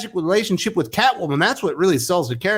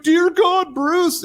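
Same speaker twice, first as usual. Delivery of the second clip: mock Selina gasp; 13 semitones higher, much slower than usual. Reviewer mocking Selina finding Bruce, high hammed-up alarm. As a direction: overplay her shock at finding him. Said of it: "And she's like".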